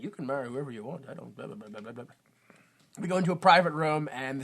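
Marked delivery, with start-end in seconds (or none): 0.0-2.1 mumbled Duke. Retold or imitated. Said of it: "the Duke's like".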